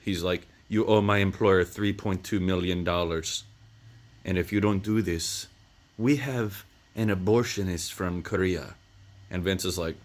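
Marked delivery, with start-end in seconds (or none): 0.7-8.7 a hint of a sinister foreigner; creepy European man. Retelling the villain who threatens Vince, lightly creepy. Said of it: "this creepy European man".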